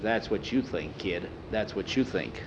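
low, quiet, lightly sneering taunt; understated sneer. A narrator's reading. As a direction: sneer it softly, keeping the voice down. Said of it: "Dale sneered at him".

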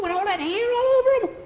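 very high, loud and fast; frantic high-pitched squeal; panicky protest. Acted. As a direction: shriek it in hammed-up disbelief. Scared boy protests in story.

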